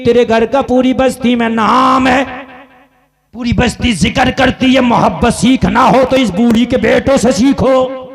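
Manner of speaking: loud, sustained declamation with drawn-out phrase endings; full-force preaching. Real, not acted. Preacher telling the crowd of the old woman's fame, full-force preaching. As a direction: ring it out to the whole crowd.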